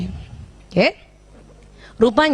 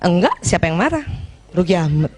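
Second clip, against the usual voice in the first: pouting wife, lower, denying she is angry; sulky denial; 6 semitones lower than usual. Retold or imitated. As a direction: huff it out like a sulking wife. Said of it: "Kita bikin ini, kita mulai nih".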